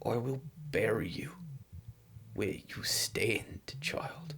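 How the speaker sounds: very soft, halting near-whisper; hushed threat. Pretend. Mother threatening to bury her child, hushed menace. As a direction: let it sink into a slow whisper by the end.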